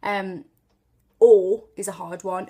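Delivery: normal talking voice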